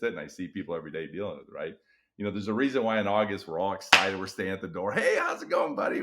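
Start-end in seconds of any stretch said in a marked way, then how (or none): 4.9-6.0 high, pumped-up hallway hello; eager greeting. Retold or imitated. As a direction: greet the kids like it's day one. Teacher greeting kids in August.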